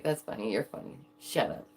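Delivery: low, deadpan comeback; mock dismissive. Streamer answering the offended people, low mock dismissal. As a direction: drop low and brush them off.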